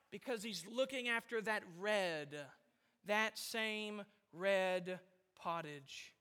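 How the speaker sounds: low, soft, very slow and deliberate; hushed emphasis. Retold or imitated. Preacher explaining why Esau threw it away, hushed and slow. Preacher dwelling on Esau's craving.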